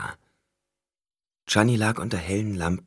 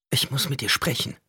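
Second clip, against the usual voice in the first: hissed; 7 semitones higher than usual. A narrator's reading. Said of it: "zischte sie".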